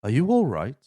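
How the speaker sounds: loud, swooping mock concern; Christopher Lloyd. Christopher Lloyd looming over Evie, loud mock concern. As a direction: exaggerate the rise and fall of the question. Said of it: "over the top of her going".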